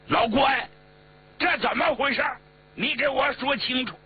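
high-pitched, heated questioning; indignant demand. A narrator's reading. He Long confronts Guan over the arrest, indignant demand. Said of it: "贺龙瞪大了眼睛".

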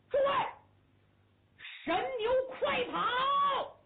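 drawn-out high shout, slow; battle cry. Acted. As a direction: roar the charge to the bulls. General yells the charge. (拉长声高喊冲锋)

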